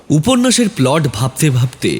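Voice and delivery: ordinary delivery, nothing marked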